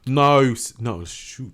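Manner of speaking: imitating a Southern "No"; failed Southern accent sliding British; mock Southern accent. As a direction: attempt a drawled Southern "No". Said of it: "I'm gonna start talking in a Southern accent".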